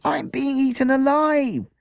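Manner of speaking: high, loud and slow mock panic; panicked cry. Child under licking hounds, loud mock panic. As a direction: wail it like you're being devoured.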